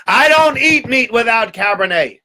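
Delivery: high, slow, imperious shout; drawn-out, high-pitched outburst; outraged duke. Acted. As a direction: bellow it like an affronted nobleman. Duke rages at poor host.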